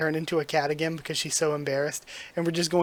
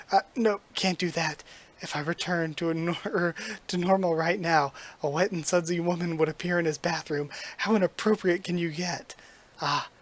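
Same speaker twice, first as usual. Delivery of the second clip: plain reading voice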